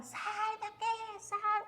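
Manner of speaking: drawn-out high scream, kept quiet; mock shriek, softened and stretched; grito imitado. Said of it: "mas gritava". (grito agudo e arrastado, em volume baixo)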